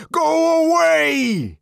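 drawn-out, high angry yell; furious shout. A narrator's reading. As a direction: shout it furiously, stretching each word. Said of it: "he shouted".

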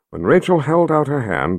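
ordinary delivery, nothing marked

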